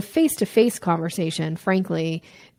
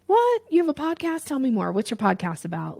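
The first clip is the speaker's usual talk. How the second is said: surprised interest; 6 semitones higher than usual. Retold or imitated. Coach voices an intrigued listener, surprised and eager. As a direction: perk up, genuinely hooked. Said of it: "they're gonna be like".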